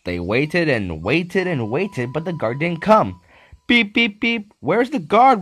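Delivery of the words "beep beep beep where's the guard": high, loud whistle-like beeps; engine whistle call. Acted. Thomas whistles for the missing guard, high and loud. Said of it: "whistled Thomas".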